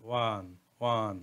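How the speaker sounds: ওয়ান, ওয়ান drawn out slowly, stressing the wa sound; slow pronunciation demo. Shown as a demonstration. Teacher repeating one for students, slow careful demo. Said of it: "ওয়ান যখন উচ্চারণ করতে হ-, করবেন তখন কিন্তু ওয়া আসতেছে".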